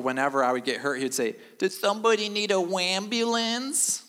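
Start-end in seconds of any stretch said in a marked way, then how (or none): 1.6-4.0 high, taunting uncle voice with rising end; mocking uncle. Uncle taunting a hurt kid, high mocking singsong. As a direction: needle them like a teasing uncle. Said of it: "He sounds like my uncle".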